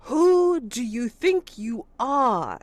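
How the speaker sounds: loud, high, slow demand; stern constable. Acted. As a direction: square up to the stranger. Constable confronts the stranger.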